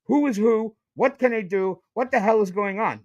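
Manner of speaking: ordinary delivery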